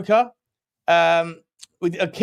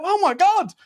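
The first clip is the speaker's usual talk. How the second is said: mock shock; 4 dB louder than usual. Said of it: "it was like".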